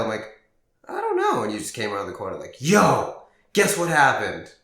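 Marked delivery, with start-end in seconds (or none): 0.9-1.4 feigned innocence. Retold or imitated. Teen lying to friend's dad, squeaky fake innocence. 2.6-4.5 louder, eager call out; mock excited call. Friend rounding the corner, calling out excitedly.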